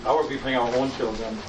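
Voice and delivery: normal talking voice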